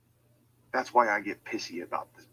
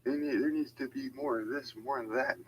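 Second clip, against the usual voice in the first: higher, whiny mock voice; mocking critics; 5 semitones higher than usual. Ranter mocking people who want new holidays, whiny and higher. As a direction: sneer it like a nagging critic. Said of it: "Now, I've heard a lot".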